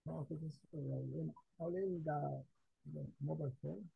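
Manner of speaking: faint phone voice, far off; talking far from a mobile phone; barely audible. Real, not acted. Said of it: "just a little bit better than it was before".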